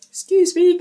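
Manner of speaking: high mid-yawn apology. Real, not acted. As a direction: stretch it through a yawn. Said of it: "I'm just yawning".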